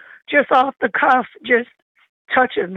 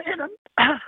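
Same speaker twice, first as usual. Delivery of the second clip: tearful catch; 5 semitones higher, 5 dB quieter than usual. Real, not acted. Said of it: "I get choked up".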